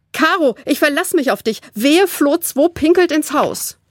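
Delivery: urgent goodbye, warning the sister; lightly urgent girl. A narrator's reading. Flo rushes off and warns Caro about the dog, lightly urgent.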